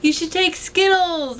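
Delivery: high, stretched-out call. Retold or imitated. Fan suggesting a gift to the singer, calling out. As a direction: pipe up slowly to get the singer's attention.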